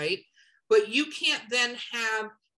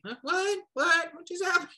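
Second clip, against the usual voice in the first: confused surprise; 7 semitones higher than usual. Pretend. Reader shocked by the genre switch.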